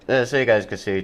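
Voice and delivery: ordinary delivery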